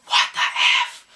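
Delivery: slow unvoiced exasperated whisper; whispered aside. Real, not acted. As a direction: breathe it out in disbelief. Exasperated aside about the accents.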